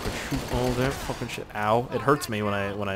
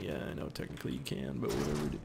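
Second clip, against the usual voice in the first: whispered aside; 8 semitones lower, 12 dB quieter, faster than usual. Retold or imitated.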